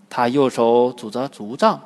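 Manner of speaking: usual speaking voice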